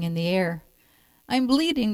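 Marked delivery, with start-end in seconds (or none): 1.3-1.9 yelped. A narrator's reading. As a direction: yelp it high in dismay. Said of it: "the fox yelped".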